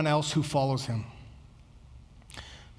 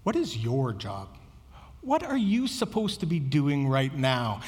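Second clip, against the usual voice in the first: low, unraised, pointed questions; pointed calm redirection; 4 semitones lower than usual. Retold or imitated. Jesus turning the apostles back to mission, low and calm. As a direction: bring them back on task with the voice kept down. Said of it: "he just kinda snaps them".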